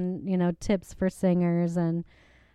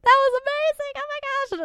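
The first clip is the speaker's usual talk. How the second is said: imitating gushing girls, much higher; excited girls. Hallmates bursting in after her song, mock gushing high. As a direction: squeal it like a starstruck hallmate. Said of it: "they were like".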